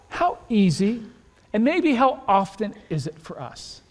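ordinary talk, nothing marked

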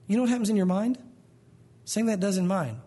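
normal talking voice

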